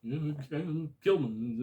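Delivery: high, loud and slow, voicing the Vulture; villain quote. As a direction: drag it out in a put-on villain voice. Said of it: "he's just like".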